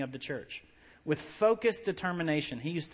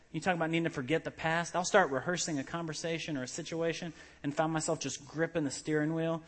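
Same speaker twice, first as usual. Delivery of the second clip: ordinary delivery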